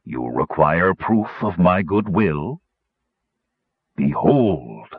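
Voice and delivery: slow, deep keeper voice; deep, drawn-out reveal; soothing bass. A narrator's reading. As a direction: proclaim it slowly and low. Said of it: "said a soothing bass voice".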